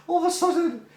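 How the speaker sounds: high-pitched, breaking into laughter mid-phrase; words squeezed out through a real laugh. Real, not acted. Storyteller cracking up before the big reveal, laughing.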